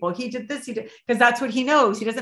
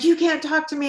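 pitched up high, defiant and cutting; angry retort; 8 semitones higher than usual. Retold or imitated. Bella refusing the coach, high defiant snap. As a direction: shove the words back at her. Bella pushes back, near attacking.

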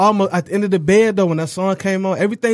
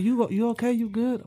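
put-on quoting the girl; 5 semitones higher, 6 dB quieter than usual. Girl voiced in story.

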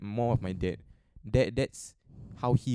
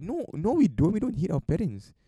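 shocked protest; 8 semitones higher than usual. Retold or imitated. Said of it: "And I was like".

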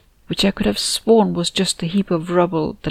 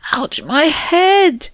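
pained cry; 10 semitones higher, 4 dB louder, much slower than usual. Acted. Pain from loud telepathy.

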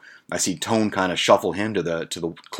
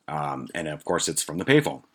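ordinary talk, nothing marked

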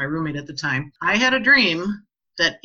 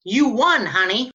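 higher and louder, falling on won; excited announcement; 5 semitones higher, 4 dB louder than usual. Retold or imitated. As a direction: break the big news. Husband announcing lottery win.

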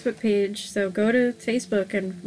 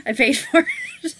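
sheepish laugh through the words; laughing admission; 8 semitones higher than usual. Real, not acted. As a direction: admit it while laughing. Laughing admission after friend's shock.

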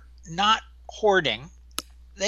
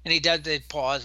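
ordinary delivery

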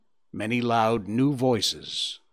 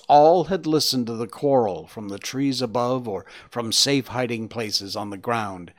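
plain reading voice

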